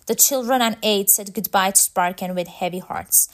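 ordinary delivery

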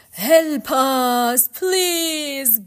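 high, wailing cry for help; loud ghostly plea. Acted. Ghosts cry to Orpheus for help, wailing loudly. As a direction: call out imploringly, drawn out.

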